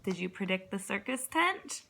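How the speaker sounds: ordinary delivery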